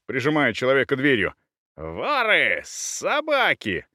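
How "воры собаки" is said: furious cries, the last high and drawn out; enraged yelling. A narrator's reading. Husband in a rage at burglars, yelling. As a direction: hurl insults at the intruders. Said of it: "орал в ярости муж".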